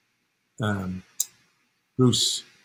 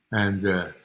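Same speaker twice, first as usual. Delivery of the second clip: ordinary talk, nothing marked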